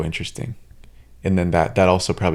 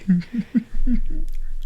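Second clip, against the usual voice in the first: high laugh. Real, not acted. Laughing at story.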